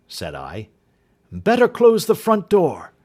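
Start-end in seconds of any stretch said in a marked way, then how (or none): 1.3-2.9 loud, high shouted order; urgent cry. Acted. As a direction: call the order out fast. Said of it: "cried Holmes".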